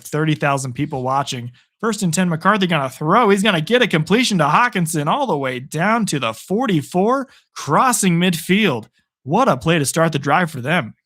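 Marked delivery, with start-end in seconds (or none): 1.8-10.9 brisk, raised sportscaster call of the drive; play-by-play call. Acted. Streamer calling his own game to viewers, play-by-play voice. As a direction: call the pass like a TV announcer.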